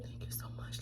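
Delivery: faint unvoiced grateful whisper. The aunt whispering thanks to the helper. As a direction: breathe the thanks. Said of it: "She looked back".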